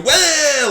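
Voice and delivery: high, stretched mock whoop; wild whoop. Imitating someone who got a taste, high wild whoop. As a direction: let loose like someone off the rails. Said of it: "you just go".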